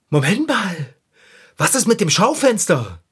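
loud gasp, then alarmed question; sudden alarm. Acted. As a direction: startle as you realise the danger. Erschrickt: das Schaufenster. (erschrockenes Innehalten, laut)

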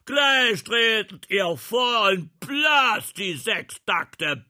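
furious roaring officer scolding his band; wütendes Brüllen. Acted. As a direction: bellow it at the lazy musicians. Angry prince scolding musicians. (wütend brüllender Fürst)

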